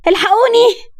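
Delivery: rising to a high, loud scream for help; girl screaming for help against her cousin; loud cry. Acted. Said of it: "مايا بصوت عالي".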